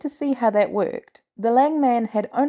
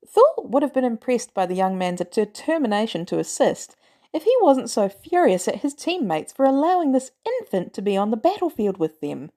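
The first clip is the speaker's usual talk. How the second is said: ordinary delivery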